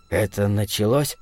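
soft, low, hesitant voice; timid question. A narrator's reading. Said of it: "спросил довольно робко". (робко, вполголоса)

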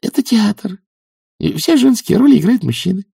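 very high, flustered voice; nervous, high-pitched young witch; timid babble. A narrator's reading. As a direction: explain nervously in a small high voice. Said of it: "пролепетала она".

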